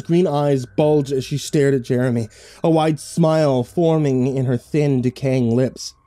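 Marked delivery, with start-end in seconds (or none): none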